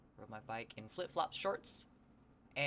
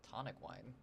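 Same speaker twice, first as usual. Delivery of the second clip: softer "Tonic wine?" with a rising tune; puzzled question; 3 semitones lower, 9 dB quieter than usual. Real, not acted. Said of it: "What do you mean by tonic wine?".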